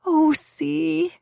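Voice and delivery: high, loud coo; high pet-name coo. A narrator's reading. Friend using her pet name, cooing high. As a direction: coo the nickname fondly. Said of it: "she says".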